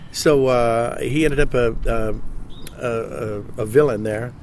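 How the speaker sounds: ordinary delivery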